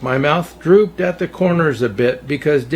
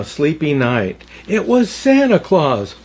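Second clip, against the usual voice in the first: plain reading voice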